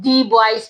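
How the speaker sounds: put-on "the" as "dee" before boys; wrong "the". Shown as a demonstration. Teacher warns of a wrong reading, "the" as dee. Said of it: "attitude ही गलत हो जाएगा".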